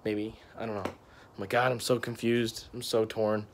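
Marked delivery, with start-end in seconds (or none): none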